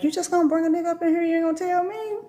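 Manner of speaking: high, fast indignant voice; mock indignant spirit. The spirits scolding her for bringing a man home, high fast indignant. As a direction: rattle off the complaint.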